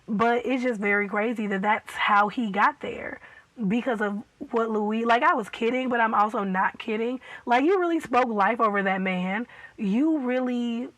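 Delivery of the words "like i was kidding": ordinary talk, nothing marked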